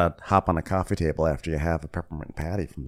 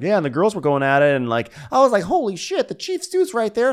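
much higher, louder guilty-guy voice; mock Eddie; 12 semitones higher, 5 dB louder than usual. Eddie telling his girlfriend about the hot tub, high mocking voice. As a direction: ham up a nervous boyfriend, high and fast.

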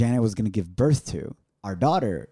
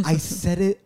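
ordinary talk

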